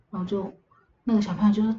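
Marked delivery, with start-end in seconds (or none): none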